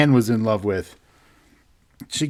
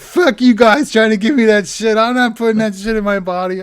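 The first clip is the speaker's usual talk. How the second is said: higher, louder hammed-up refusal; mock defiant shout; 9 semitones higher, 3 dB louder than usual. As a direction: shout it like a stubborn partier. Guy refuses vaccine again.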